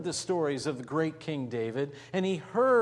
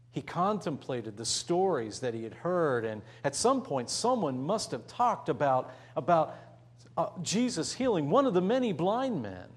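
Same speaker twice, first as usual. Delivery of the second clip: ordinary talk, nothing marked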